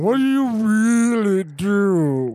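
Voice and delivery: slow, mocking snob voice; snooty rich guy. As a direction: drag it out like a snob. Chip voiced in retelling.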